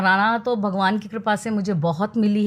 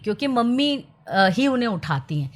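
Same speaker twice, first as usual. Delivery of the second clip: usual speaking voice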